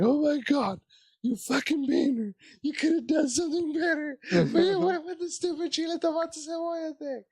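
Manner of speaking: high, half-sobbing whine; whiny self-scolding. Retold or imitated. Comic scolding himself offstage, high mock-crying whine. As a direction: berate yourself in a hammed-up wail.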